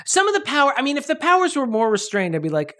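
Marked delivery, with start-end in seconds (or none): none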